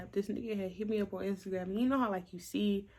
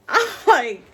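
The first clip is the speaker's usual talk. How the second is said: loud laugh; 6 semitones higher, 17 dB louder than usual. Real, not acted.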